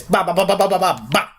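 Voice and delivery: rapid-fire thudding "ba" syllables; imitated smashing noises. Shown as a demonstration. Hulk flinging a rag doll around, imitated thuds. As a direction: rattle off repeated whacks, ending on one last hit. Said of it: "like a freaking rag doll like this".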